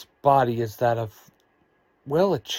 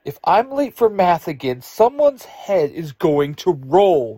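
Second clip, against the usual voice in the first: loud, high, impatient snap at her friends; snapping; 6 semitones higher, 7 dB louder than usual. A narrator's reading. Late for math, snapping at friends to hurry, loud and high. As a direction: hurry them along with a sharp, raised voice. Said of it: "Ruthie snaps".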